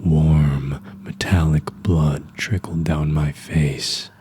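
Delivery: ordinary delivery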